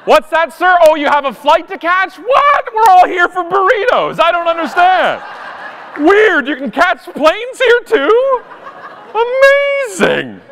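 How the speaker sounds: very high, exaggerated mock surprise; passive-aggressive politeness. To a late man with a flight to catch, sarcastic astonishment. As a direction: feign utter amazement, squeaking up high. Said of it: "passive-aggressive politeness".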